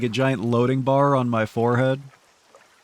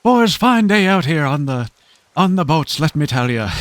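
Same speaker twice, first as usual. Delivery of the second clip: put-on Newfie accent; 3 semitones higher, 5 dB louder than usual. Streamer's third try for chat, hammed-up Newfoundland fisherman accent. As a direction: greet the boys like a Newfoundland fisherman. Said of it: "they always say boys".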